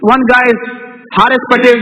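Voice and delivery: ordinary talk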